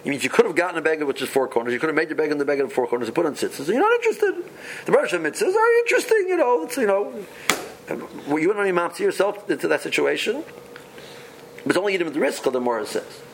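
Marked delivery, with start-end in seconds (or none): 3.6-7.1 mocking. Mocking the reluctant person.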